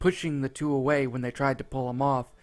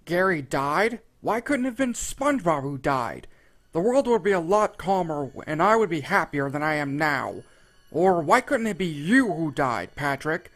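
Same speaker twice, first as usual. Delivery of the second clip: higher, louder cruel yell; Squidward yelling back; 4 semitones higher, 8 dB louder than usual. A narrator's reading. Squidward yelling cruelly back at Patrick, loud and nasty. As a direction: spit the insults back hard. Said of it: "yelling at him".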